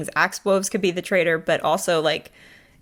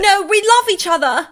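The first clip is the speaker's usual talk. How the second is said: mock posh British; 13 semitones higher, 4 dB louder than usual. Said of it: "he's like".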